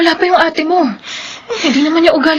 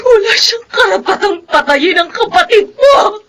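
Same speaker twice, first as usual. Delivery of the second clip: crying outburst; 2 semitones higher than usual. Acted. Mother cursing her daughter's killer, crying shout. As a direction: spit out the curse through sobs.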